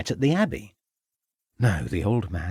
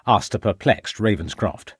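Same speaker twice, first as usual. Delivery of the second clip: ordinary delivery, nothing marked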